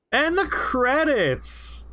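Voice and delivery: high, drawn-out flourish; showy announcement. Real, not acted. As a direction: stretch it out high like a big reveal. Showy announcement during play.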